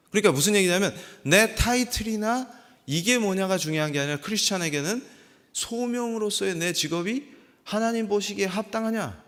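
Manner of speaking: usual speaking voice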